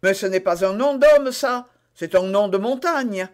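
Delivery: mildly exasperated interrogator; exclamation exaspérée. A narrator's reading. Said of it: "s'écria le pauvre interrogateur". (interrogateur qui perd un peu la tête)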